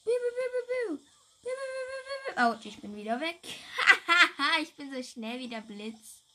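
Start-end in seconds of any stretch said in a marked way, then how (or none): 0.1-2.4 high-pitched biu shot sounds; high laser noises. Pretend. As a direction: fire off squeaky laser blasts. Imitating shots while playing. (hohe Laser-Schussgeräusche)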